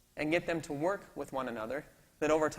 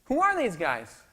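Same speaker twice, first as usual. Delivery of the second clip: louder, higher alarmed demand; alarmed protest; 8 semitones higher, 10 dB louder than usual. Retold or imitated. Security head confronting Mandela, alarmed loud protest. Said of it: "runs into Mandela's office and says".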